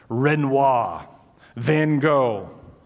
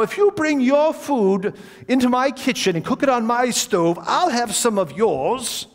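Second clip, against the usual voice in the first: put-on old gentleman voice; 7 semitones higher than usual. Old host offers dinner.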